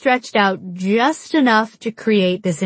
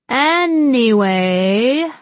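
drawn-out, lilting singsong, higher pitched; impatient singsong; 4 semitones higher, much slower than usual. A narrator's reading. Mallory interrupting the flirty chat, impatient singsong. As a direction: sing it out slowly to cut in impatiently. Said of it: "sang Mallory impatiently".

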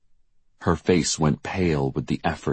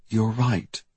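higher, contained agreement; suppressed panic; 6 semitones higher than usual. A narrator's reading. Diane soothing Jackie back to bed, suppressed panic. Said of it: "trying to keep her panic from showing".

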